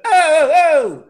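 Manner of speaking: high, loud mock hollering; New York exclamation. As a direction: belt it out like a cranky New Yorker. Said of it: "that's New York".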